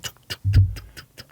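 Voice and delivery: crisp voiceless "tuk" clicks, dying away; imitated puck clacks. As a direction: tap out a passed puck with the mouth. Imitates the puck being passed.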